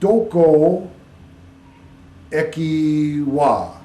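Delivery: "Doko eki wa" word by word, loud and drawn out; learner building a sentence. Retold or imitated. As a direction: piece it together slowly, holding each word. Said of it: "too often what Americans will do".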